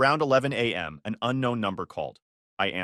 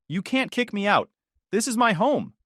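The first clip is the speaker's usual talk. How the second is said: high-pitched, affronted wife; indignant protest; 8 semitones higher, 3 dB louder than usual. A narrator's reading. Wife refusing to leave the house, indignant.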